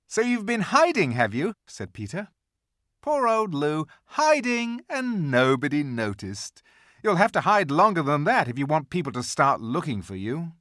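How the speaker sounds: put-on a touch of a teasing older boy; teasing big brother. A narrator's reading. Voiced older brother Peter.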